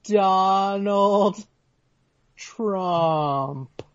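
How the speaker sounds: each word hugely drawn out; very slow emphasis. Real, not acted. Co-host waiting for the host to react, very slow emphasis. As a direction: stretch the name out and let it hang. Repeats name for effect, awaiting reaction.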